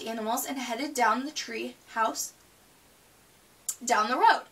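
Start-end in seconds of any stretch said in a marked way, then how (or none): none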